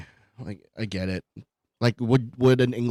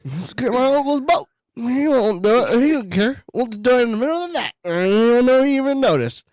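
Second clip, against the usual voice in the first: put-on goofy kid voice; 8 semitones higher than usual. Voicing drunk kid planning.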